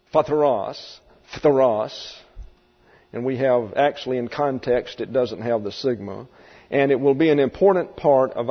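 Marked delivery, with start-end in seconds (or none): 0.1-2.2 phatheros, sounded out; Greek word said twice. Shown as a demonstration. Teacher naming the Greek noun for the class. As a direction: pronounce phatheros carefully. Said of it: "translate the Greek noun".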